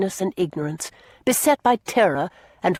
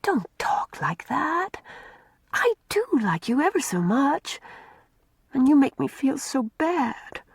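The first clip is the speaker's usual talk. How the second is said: slow, hushed pleading whisper, higher; tender plea; 7 semitones higher, 3 dB quieter than usual. A narrator's reading. Una tenderly begging Mary not to be cross. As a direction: soothe her gently and slowly. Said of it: "pushing a tender arm".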